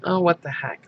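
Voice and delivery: normal talking voice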